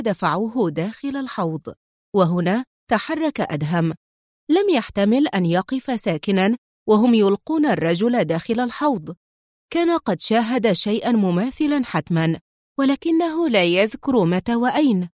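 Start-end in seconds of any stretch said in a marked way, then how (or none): none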